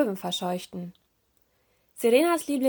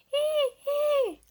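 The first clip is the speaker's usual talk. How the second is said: two very high, long imitated gull screeches; hungry seagull cries. A narrator's reading. First hungry gulls over the turtles, screeching. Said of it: "von den Möwen".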